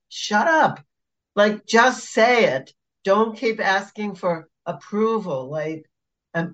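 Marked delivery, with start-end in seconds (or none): 0.1-5.5 higher-pitched, exasperated scolding; fed up, pitched up and emphatic; exasperated retort. Acted. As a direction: tell them off, slowing to hammer it home. Snaps at imagined approval seekers.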